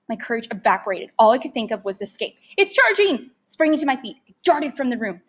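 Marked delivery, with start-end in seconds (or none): 2.6-3.3 high panicked cry; panicked alarm. A narrator's reading. Boy crying out as the rat charges. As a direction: warn in terror.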